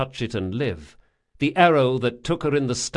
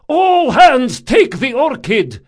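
high, loud battle shout; 13 semitones higher, 11 dB louder, slower than usual. A narrator's reading. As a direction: roar orders over the fire. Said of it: "shouted Rodanov".

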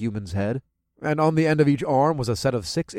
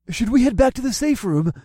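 fearful; 12 semitones higher, 4 dB louder than usual. A narrator's reading. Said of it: "with a twinge of fear in his voice".

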